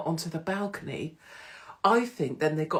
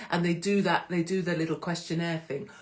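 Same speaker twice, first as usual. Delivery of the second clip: usual speaking voice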